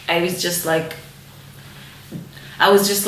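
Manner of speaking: ordinary delivery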